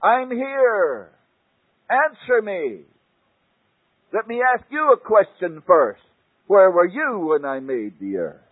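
mighty divine voice, pitched high and loud; terrible voice of God. Retold or imitated. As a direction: thunder down at Job from the whirlwind. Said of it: "came the voice out of the tornado".

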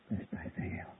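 whispered, breathless fear. Acted. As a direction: let it drop to a whisper. Whispered plea.

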